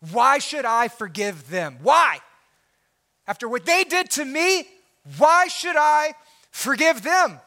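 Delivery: a hurt objector pushing back with each why; indignant protest. Acted.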